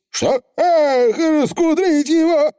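high anguished pixie oath; dismayed Feegle. Acted. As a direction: cry out in dismay. Feegle dismayed, cry heard.

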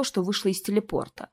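ordinary delivery, nothing marked

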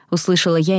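ordinary delivery, nothing marked

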